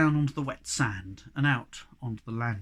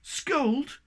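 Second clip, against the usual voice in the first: indignant surprise; 12 semitones higher, 4 dB louder than usual. A narrator's reading. Snork offended at the idea.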